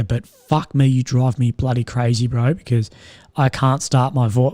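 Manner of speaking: ordinary talk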